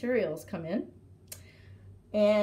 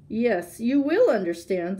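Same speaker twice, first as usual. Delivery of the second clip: mild storybook roar, louder than narration; 8 dB louder than usual. A narrator's reading. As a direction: insist loudly as the tiger. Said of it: "the tiger roared".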